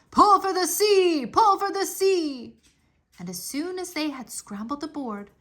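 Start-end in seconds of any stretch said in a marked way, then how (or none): 0.1-2.6 loud urgent yell, higher. A narrator's reading. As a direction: yell to the rowers to pull hard. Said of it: "Odysseus started to yell".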